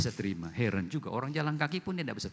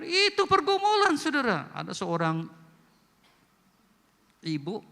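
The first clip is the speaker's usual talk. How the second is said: normal talking voice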